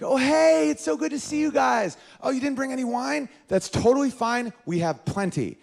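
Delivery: high sing-song fake-nice hostess; imitated polite hostess with a hidden dig; gushing hostess. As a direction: greet guests as an oversweet friend's wife. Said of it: "my buddy's wife comes to the door".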